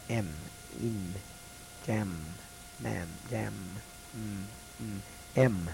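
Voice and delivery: soft, hummed "M" repeated for the class; drilling the M sound. Shown as a demonstration. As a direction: hum the "M" slowly for students to copy. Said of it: "You might try it with me when I say it".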